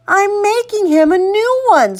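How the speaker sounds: put-on high-pitched kid's excuse; insistent little-boy voice; defensive child. A narrator's reading. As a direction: argue back high, like a small boy. Boy defends his broken toy.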